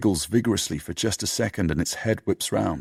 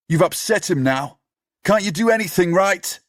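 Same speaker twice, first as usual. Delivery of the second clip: high, loud and exasperated; scolding; 12 semitones higher, 5 dB louder than usual. Acted. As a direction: tear into him for failing. Scolding Sid after puppy.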